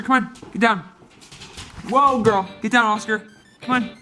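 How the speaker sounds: ordinary talk, nothing marked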